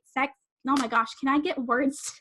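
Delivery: usual speaking voice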